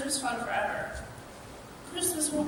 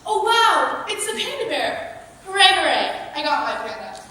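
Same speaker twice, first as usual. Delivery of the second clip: fakey surprise; 2 semitones higher, 7 dB louder than usual. Child practising surprise at a Christmas panda, fakey excitement. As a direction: overdo a rehearsed show of surprise and joy. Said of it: "sounds too fakey".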